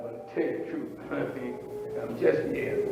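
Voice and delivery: soft, breathy admission with a laugh; failed dreamer admitting defeat, whispered and laughing; sheepish whisper. Acted. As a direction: confess it under your breath, embarrassed. Voiced person sheepishly admitting.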